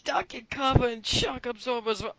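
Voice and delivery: loud, high gushing; excited shout. Real, not acted. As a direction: yell it like a hyped fan. Gushing loudly about minigames.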